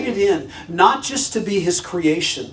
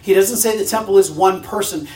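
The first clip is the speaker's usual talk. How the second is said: normal talking voice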